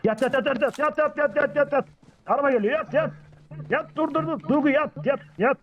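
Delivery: high, rapid repeated orders to drop; urgent warning. Pretend. Streamer warning squad a car is coming, urgent. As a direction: pretend alarm, pitched well up.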